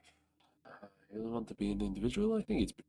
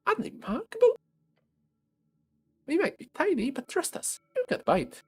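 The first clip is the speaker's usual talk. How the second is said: very high, loud squeaky outrage; tiny voice; 7 dB louder than usual. Acted. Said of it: "Oh wait".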